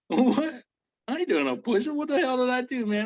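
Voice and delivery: high-pitched, incredulous retort; mock outraged disbelief; indignant protest. Retold protest at push-up order.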